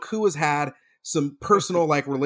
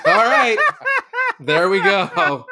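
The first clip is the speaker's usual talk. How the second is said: amused cheer; 5 semitones higher, 6 dB louder, slower than usual. Retold or imitated. Reacting to cashier.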